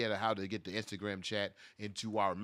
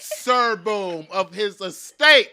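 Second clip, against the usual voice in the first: high, loud, slow proclamation; mock-grand herald; 8 semitones higher, 14 dB louder, much slower than usual. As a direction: announce him like arriving nobility. Mock-grand title for newlywed commenter.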